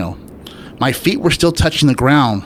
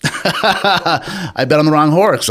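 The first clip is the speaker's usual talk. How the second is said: laughing; 6 semitones higher, 3 dB louder, much slower than usual. Real, not acted. Laughing at own conversion.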